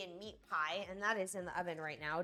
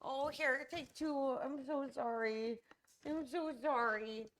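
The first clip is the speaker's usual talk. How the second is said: tender pet talk, pitched up; 7 semitones higher than usual. Real, not acted. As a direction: soothe the dog gently. Comforting the hurt dog.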